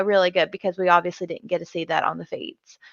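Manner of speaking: ordinary delivery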